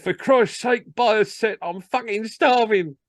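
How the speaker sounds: high, desperate comic plea; hammed-up salesman breaking down; tearful begging. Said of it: "burst into tears".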